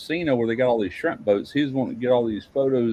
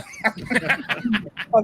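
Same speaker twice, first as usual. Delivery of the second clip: laughing; 11 semitones higher than usual. Real, not acted. Laughs after the quote.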